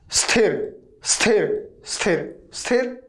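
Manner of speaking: स्थिर starting straight on the s, no ई before it; correct pronunciation drill. Shown as a demonstration. Teacher drilling students that ई won't come first, s-initial स्थिर. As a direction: drill स्थिर with a hissed s leading into थिर. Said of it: "ई पहले नहीं आएगा".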